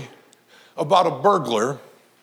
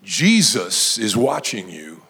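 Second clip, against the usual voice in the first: slow, very low warning from the dark; low, slow and clear; 10 semitones lower than usual. Acted. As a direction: deliver an ominous warning, drawn out. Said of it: "again he heard clear as a bell".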